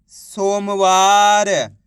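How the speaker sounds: very drawn-out model word; slow blended reading. Shown as a demonstration. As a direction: stretch every syllable while joining them into one word. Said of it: "इनको आपस में मिला के पढ़ना है". (अक्षर मिलाकर खूब खींचकर पढ़ना)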